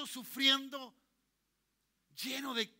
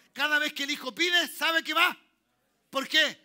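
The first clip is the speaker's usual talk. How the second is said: much louder and higher, rousing the church; louder preaching; 4 semitones higher, 13 dB louder than usual. Shown as a demonstration. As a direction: lift the crowd with a fiery repeat. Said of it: "¿hay alguien dijo amén más fuerte o no?".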